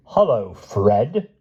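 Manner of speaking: a bright, slow, rising hello; mock-cheery greeting. Harbin hailing Wilby with mock cheer. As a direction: greet him like an old friend with menace.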